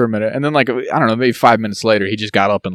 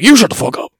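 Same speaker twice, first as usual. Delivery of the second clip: mock angry snap; 9 semitones higher, 4 dB louder than usual. Imitating the rude talker.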